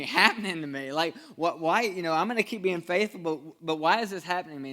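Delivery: loud, driving sermon delivery; preaching at full force. Acted. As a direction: preach it out to the whole room. Preacher retells Joseph story loudly.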